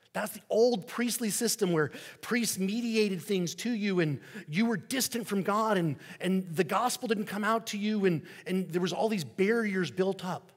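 rising pulpit intensity; fervent preaching. Acted. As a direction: drive the point home to the congregation. Preaching against old priestly system.

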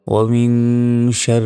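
tajweed recitation with held nasals; measured, sustained model recitation; Quran recitation. Shown as a demonstration. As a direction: hold the nasal sounds long.

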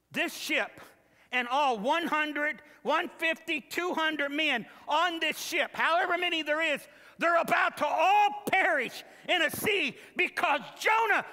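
rising to a loud high cry; sermon climax, shouting; building preacher's shout. Acted. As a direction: build each phrase until you shout.

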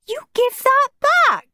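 put-on very high little-boy yell; high-pitched protest; indignant shout. A narrator's reading. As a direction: shout it back in a high squeal. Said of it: "shouted Peter".

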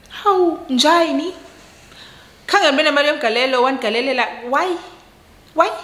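high-pitched, baffled questioning; shocked disbelief. Retold or imitated. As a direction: re-enact stunned confusion, voice jumping up. Acting out her shocked reply on phone.

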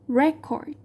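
"record" stressed at the front, RE-cord; stress on first syllable. Shown as a demonstration. Teacher repeats the noun for students, first-syllable stress. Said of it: "có cách đọc của từ record là".

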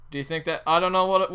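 normal talking voice

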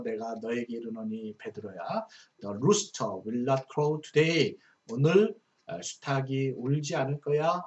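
ordinary talk, nothing marked

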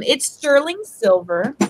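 normal talking voice

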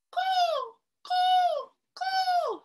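very high, evenly spaced caws; crow cawing. Pretend. Reader plays crow for the children, cawing. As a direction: call out like a crow. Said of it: "Caw like a crow".

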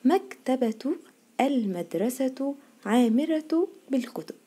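slow model reading, "مكتبة" as maktabatu; careful linked endings in "مكتبة المدرسة عامرة"; taa when continuing. Shown as a demonstration. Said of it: "عشان كملنا قلنا ايه؟".

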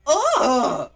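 loud, high cry; high wordless outburst; startled yell. Real, not acted. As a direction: yell out in shock. Reacting to the video.

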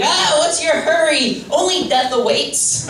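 high, drawn-out mock yell. Driver yelling at people in the fast lane, mock yelling. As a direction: cry it out slowly and high, hammed-up. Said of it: "yelling at the people in the fast lane".

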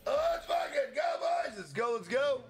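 pumped streamer hype call; hype shout. Real, not acted. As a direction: cheer the chat on at full pitch. Hype cheer after share plea.

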